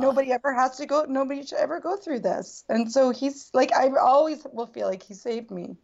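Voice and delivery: high-pitched, on the verge of tears; real gratitude, voice lifted with emotion; emotional. Real, not acted. Guest telling how he saved her, tearfully emotional. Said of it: "I'm gonna get emotional".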